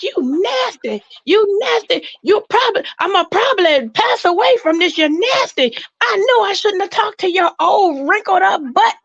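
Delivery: high, scolding voice of a wife; cussing him out. Retold or imitated. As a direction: tear into him like a betrayed wife. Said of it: "she was just cussing him out".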